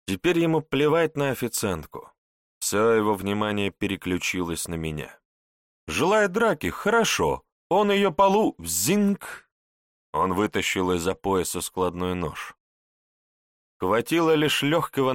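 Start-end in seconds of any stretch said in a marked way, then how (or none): 5.9-9.5 thug voice. A narrator's reading. Bandit voiced mocking challenge.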